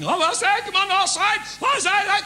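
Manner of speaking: very high, loud excited yell; football shout. Acted. Little fella at the football match yelling again, loud high shout. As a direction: roar at the game like a fan.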